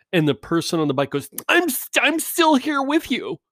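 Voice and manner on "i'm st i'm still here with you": much higher, slow, earnest mock reply; hammed-up fake sincere commercial rider. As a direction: play the phony eager rider answering the screen. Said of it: "When I do this voice, it means I'm mocking them".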